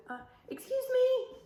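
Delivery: put-on high, light little-girl voice; sweetly polite child. A narrator's reading. Teacher voicing the girl squeezing past the dinosaur. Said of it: "She said".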